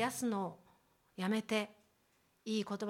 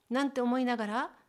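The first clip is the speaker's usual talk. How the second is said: ordinary talk, nothing marked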